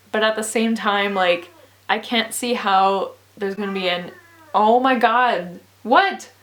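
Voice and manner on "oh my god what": louder, rising exasperated "what?"; exasperated disbelief. Real, not acted. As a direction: react in disbelief to the noise. Reacts to Tiger still yelling.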